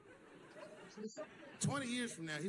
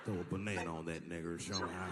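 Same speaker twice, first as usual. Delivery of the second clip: lower mock voice; 10 semitones lower than usual. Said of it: "I said".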